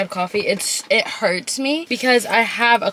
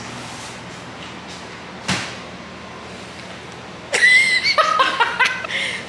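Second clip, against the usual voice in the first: long high unvoiced giggle fit; high burst of laughter. Real, not acted. Laughing at the friend's story.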